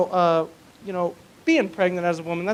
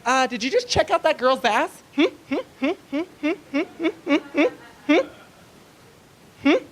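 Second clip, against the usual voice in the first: higher, rapid-fire questioning hums; lightly mimicked suspicious wife; 6 semitones higher than usual. Wife catches husband looking at a girl, mock suspicious. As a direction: pester him with short, insistent hums. Wife quizzing him at the store.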